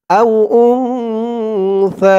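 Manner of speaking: আও উনসা drawn out slowly, the নুন hidden in a nasal hum before সা; slow nasal ikhfa on উনসা; ikhfa ghunna demo. Shown as a demonstration. Teacher demonstrating ikhfa ghunna in a Quran recitation lesson. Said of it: "ইকফার যতগুলো গুন্না".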